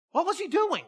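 high-voiced, wondering query; puzzled repeat. Real, not acted. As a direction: lift the whole question way up high.

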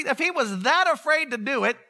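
normal talking voice

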